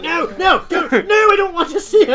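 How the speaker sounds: high, loud, fast panicked pleading; distraught shouted refusal. Acted. Villain Michael refusing to see his mother, high panicked shouting. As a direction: shout it in a rising panic, near tears. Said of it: "And he starts crying".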